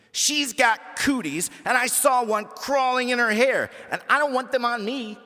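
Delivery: put-on a nasty kid pleading her case; bratty Imogene. Imogene to the principal, high whiny tattling.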